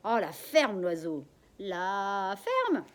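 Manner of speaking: loud, fed-up shout; angry snap at bird. Acted. Daughter yelling at the noisy parrot, angry shout. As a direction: shut the parrot up.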